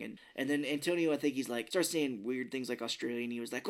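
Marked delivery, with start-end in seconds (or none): none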